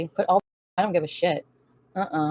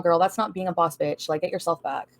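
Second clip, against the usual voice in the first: ordinary talk, nothing marked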